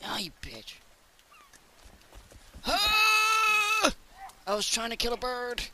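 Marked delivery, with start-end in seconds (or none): none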